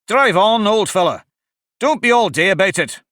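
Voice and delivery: impatient. A narrator's reading. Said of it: "the Griffin said to the Mock Turtle".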